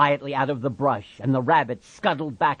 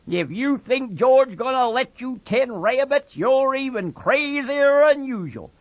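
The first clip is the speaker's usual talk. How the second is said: higher, louder mocking voice; taunting rabbit; 12 semitones higher, 5 dB louder than usual. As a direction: rub his nose in it.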